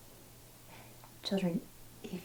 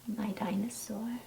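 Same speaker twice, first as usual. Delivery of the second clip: whispered, much softer tender refrain; 9 dB quieter than usual. Acted. Teacher to her class, tender whispered refrain. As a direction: breathe it out like a secret.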